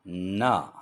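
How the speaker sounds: teacher models ना for the learners; model sound ना. Shown as a demonstration. Teacher repeating the letter ना for students, clear and firm. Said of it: "दोहराता हूँ".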